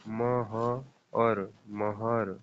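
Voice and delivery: मह और महर stretched out very slowly; slow syllable demo. Shown as a demonstration. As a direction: pull मह and महर apart to show the अर sound. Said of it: "बीच में उच्चारण करने से क्या आ रहा है". (मह और महर को धीरे-धीरे खींचकर बोलिए)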